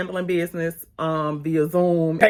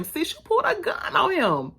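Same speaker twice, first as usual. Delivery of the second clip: scolding; 11 semitones higher than usual. Acted. Scolding character on show.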